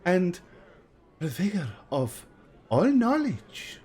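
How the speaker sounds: put-on high, slow, theatrical old fortune teller. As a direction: drawl it out like a mystical seer. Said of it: "He says".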